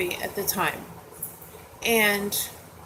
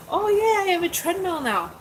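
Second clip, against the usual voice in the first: much higher, excited exclamation; retold delight; 9 semitones higher than usual. Retelling her joy at owning a treadmill, high and excited. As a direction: burst out happily in a high pitch.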